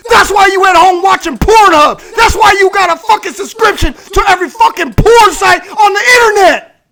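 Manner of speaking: loud, high furious rant; angry shouting. Real, not acted. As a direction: yell it at them in contempt. Ranting shout at MGTOW men.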